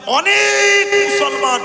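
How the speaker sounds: very high, loud and slow; preaching shout. Real, not acted. Preacher building to a climax before the crowd, loud and slow. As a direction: draw the words out at full force.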